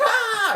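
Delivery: high loud yelp of pain. Retold or imitated. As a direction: yelp as the bat hits. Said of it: "He's like".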